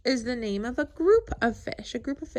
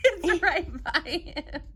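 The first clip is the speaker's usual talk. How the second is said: laughing through the words, slow; real laughter at the funny picture; 5 semitones higher, slower than usual. Real, not acted. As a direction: giggle while you point it out.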